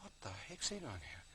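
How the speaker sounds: soft retold bafflement; muted disbelief. Man sees another face appear before him, muted disbelief. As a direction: wonder it under your breath.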